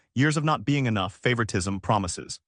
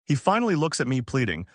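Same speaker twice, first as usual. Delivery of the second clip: usual speaking voice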